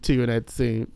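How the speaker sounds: ordinary delivery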